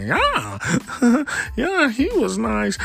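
put-on very high squealing girly voice with a laugh; imitating her giggly laugh. Mocking the commentator girl's high giggling voice. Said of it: "She was like".

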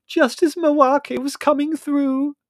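loud, high, drawn-out complaint; angry. A narrator's reading. Margot cheated of her spirit guide, angry. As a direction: wail it furiously, stretching the words. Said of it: "said Margot angrily".

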